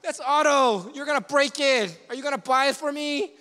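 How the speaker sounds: exasperated scolding. Retold or imitated. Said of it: "You know".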